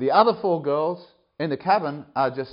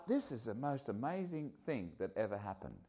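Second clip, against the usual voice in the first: much softer, awed; hushed awe; 2 semitones lower, 15 dB quieter than usual. Retold or imitated. Director marvelling at the revival, hushed. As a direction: breathe it out in wonder.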